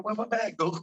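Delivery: high, put-on crewman voice; lightly voiced confused deckhand; mock crewman. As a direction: act out a puzzled deckhand. Crew voiced in story.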